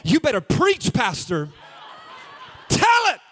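loud, high call-outs from the pews; shouting congregant. Acted. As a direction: egg the preacher on at full voice. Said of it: "so I'm just gonna sit right here".

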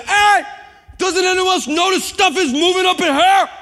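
high, loud panicked yell; panicked shout. Retold or imitated. Preacher yelling to the room during the quake. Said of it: "and I finally said".

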